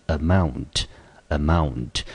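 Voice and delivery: "amount" said slowly, twice, MOUNT stressed; a-MOUNT, slow and clear, stress the second part; slow model of "amount" twice; stress on the second syllable. Shown as a demonstration. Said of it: "重音节落在第二个音节".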